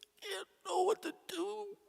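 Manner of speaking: high, broken despair; grieving mother telling the priest she feels helpless; helpless plea. Retold or imitated. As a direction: speak as if breaking down. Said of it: "She said".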